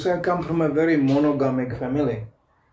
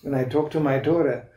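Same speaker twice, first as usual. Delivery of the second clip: ordinary talk, nothing marked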